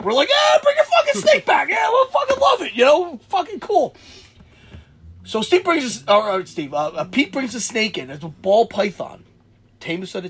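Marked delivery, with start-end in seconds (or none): 0.3-3.9 high, loud retold enthusiasm; rowdy mock-excited cheer. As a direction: egg your buddy on, voice way up. Retells friends cheering on the snake.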